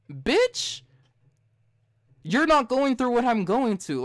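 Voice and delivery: retold angry outburst; furious comeback. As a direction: put her in her place. Snapping back at the lady.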